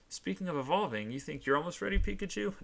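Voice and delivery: high, sing-song ribbing; teasing. Acted. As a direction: tease with rising lilt. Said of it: "She asked teasingly".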